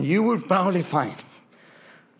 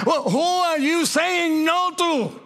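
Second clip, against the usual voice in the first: God rebuking; 11 semitones higher, slower than usual. Acted. Repeated rebuke question.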